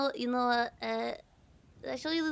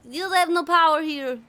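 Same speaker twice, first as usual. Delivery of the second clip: booming dramatic decree; mock villain declaration; 5 semitones higher, 12 dB louder than usual. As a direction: proclaim it like a grand villain.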